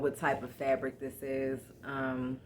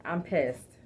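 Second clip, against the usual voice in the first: ordinary delivery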